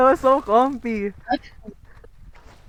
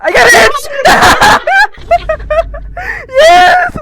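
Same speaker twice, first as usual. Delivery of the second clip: high screaming victory cheers; triumphant shouting; 14 semitones higher, 4 dB louder, much slower than usual. Real, not acted. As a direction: scream with joy after winning. Winning the chair.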